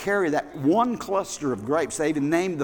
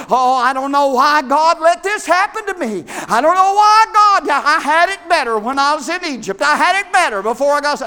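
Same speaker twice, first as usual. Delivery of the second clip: louder, higher self-pitying whine; mock whining complainer; 8 semitones higher, 8 dB louder than usual. Preacher mocking a whining congregation, high loud whine. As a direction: mimic a grumbling believer, hammed up. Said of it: "That sounds like a bunch of us".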